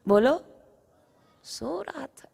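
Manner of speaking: plain reading voice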